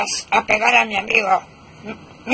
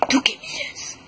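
much higher, thin cartoon voice; high squeaky voice; 6 dB quieter than usual. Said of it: "No cambies de voz".

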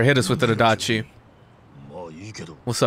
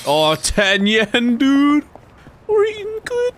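drawn-out start, rising into a squeak; mock-thrilled excitement; 11 semitones higher than usual. Real, not acted. Player to chat finding ten yen, squealing excitement. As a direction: pitch climbing to a high squeal. Finds money in the game.

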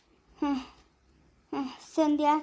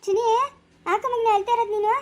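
put-on soothing a child; 5 semitones higher than usual. Mother soothing crying child.

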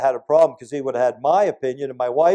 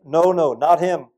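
ordinary talk

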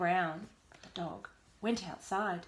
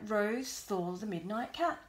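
ordinary delivery, nothing marked

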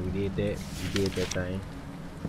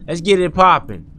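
loud, raised challenge, ready to fight; heated; 8 semitones higher, 8 dB louder than usual. Real, not acted. Player daring the game to get it popping, heated and loud. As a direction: square up and call them out. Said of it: "I'm heated".

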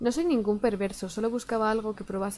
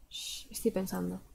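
soft hush; 2 semitones lower, 9 dB quieter than usual. Acted. Player voicing the character.

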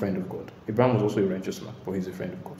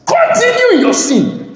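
much higher, louder, slow mock dare; sarcastic shout; 10 dB louder, slower than usual. Real, not acted. Preacher mockingly shouting at unrepentant listeners. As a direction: shout it with scorn.

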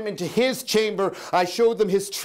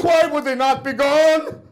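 higher, louder anguished plea; frantic louder outburst; desperate cry; 8 semitones higher, 5 dB louder than usual. Acted. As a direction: cry it out in despair.

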